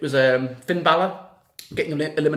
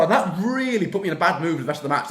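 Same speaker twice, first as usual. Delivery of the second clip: usual speaking voice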